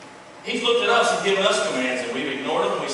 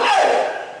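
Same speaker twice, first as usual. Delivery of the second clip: protective shout; 12 semitones higher than usual. Acted. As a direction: shout it, stepping in for your friend. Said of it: "you're gonna go".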